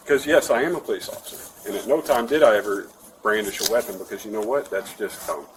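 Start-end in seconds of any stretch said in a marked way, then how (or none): none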